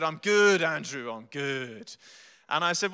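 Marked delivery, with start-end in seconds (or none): 0.0-0.6 put-on Cockney accent. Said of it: "He's got a thick Cockney accent". 0.6-1.9 put-on Cockney accent. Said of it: "He's got a thick Cockney accent".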